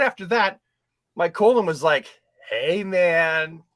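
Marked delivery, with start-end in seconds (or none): 2.5-3.6 a touch of a lazy stoner voice; seventies druggie. Retold or imitated. His colon tempting him, in a lightly voiced stoner voice. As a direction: lightly play a laid-back seventies druggie. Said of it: "that voice is like a druggie from the seventies".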